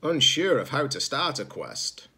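plain reading voice

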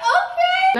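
laughing squeal. Real, not acted. Being lifted.

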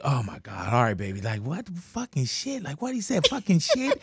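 quiet, stunned whisper-like asking; hushed disbelief. Retold or imitated. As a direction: ask under your breath, shocked. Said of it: "And I'm like".

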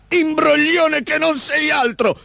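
high, slow, outraged creature voice; thundering ogre. A narrator's reading. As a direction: rage at the cheat in a high ogre voice. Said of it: "tuonò l'omone". (orco furioso, voce acuta)

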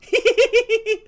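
loud, very high impish giggle; high-pitched giggle. Pretend. Hideous freak watching the crowd flee, high giggle. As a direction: giggle wickedly in a squeaky high register. Delighted that everyone ran away.

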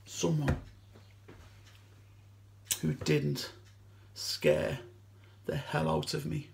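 low, soft and halting; hushed admission. Acted. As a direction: let the words drop out quietly, piece by piece. Admits he was scared of her.

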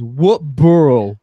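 loud, drawn-out New York "What borough?"; hammed-up New York accent, slow and loud; corrected New York accent. Correcting the co-host's quote of what was asked, exaggerated New York accent. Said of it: "No, that wasn't it. It was".

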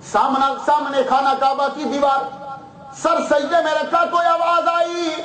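high, rising sermon delivery; fervent preaching. Acted. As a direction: build the scene with a preacher's lift. (بلند خطیبانہ انداز)

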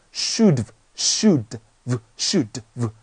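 "should've" slowly, ending in a bare v; slow demo splitting "should've" into should plus v; contracted reading. Shown as a demonstration. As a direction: tack a tiny v onto "should". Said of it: "缩读".

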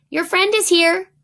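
high, loud call up the stairs; mother calling her child down; calling upstairs. A narrator's reading. Mother calls up the stairs to Eunhae, loud and high. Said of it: "Mother called up to Eunhae".

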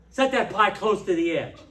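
loud, incredulous repeat of the complaint; mocking repeat. Shown as a demonstration. To the congregation, scoffing at the careless pie setter. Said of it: "That's about as dumb as it gets".

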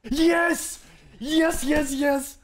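very high, loud cheering; real victory shout; triumphant yell. Real, not acted. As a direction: cheer like you just won. Triumph in a fight.

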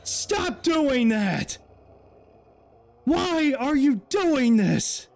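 high, slow, pleading with the ghost; frightened pleading. Real, not acted. Player pleading with the haunting ghost, frightened. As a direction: beg it to stop, scared for real. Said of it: "I'm freaking out".

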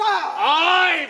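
loud high cry of ay; shout. Acted. Sir Toby crying out loudly at Malvolio. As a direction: burst out with it. Toby's outburst.

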